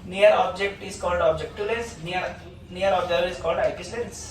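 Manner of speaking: slow, word-by-word dictation; patient classroom dictation. Acted. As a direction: read the definition out for copying. Teacher dictating definitions.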